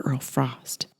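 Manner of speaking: breathy whisper of his name. Acted. Holly cooing Earl's name, soft whisper. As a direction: whisper it close in his ear.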